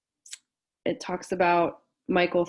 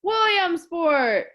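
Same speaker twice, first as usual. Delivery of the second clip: loud drawn-out cheer; yelled; 11 semitones higher, 9 dB louder, much slower than usual. Acted. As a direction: shout it out, long and loud. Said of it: "they yelled".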